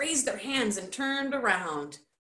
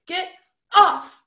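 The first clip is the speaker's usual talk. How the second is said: loud, high tearful outburst; sobbed protest; 11 semitones higher, 10 dB louder than usual. A narrator's reading. Roderick shrugging away from Bert's arm, sobbing shout. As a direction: burst out as if sobbing. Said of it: "sobbed Roderick".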